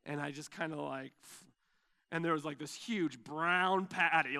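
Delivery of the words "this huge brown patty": usual speaking voice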